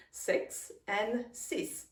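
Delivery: slow, Six with English i, then six as French sis. Shown as a demonstration. Teacher contrasting English and French six. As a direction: contrast the two sixes slowly. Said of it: "very different pronunciation".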